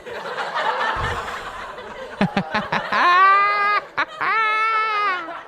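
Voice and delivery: long shrieking laugh, way up high; real helpless laughter; high wild laugh. Real, not acted. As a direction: burst out cackling. Big laugh at sound effect.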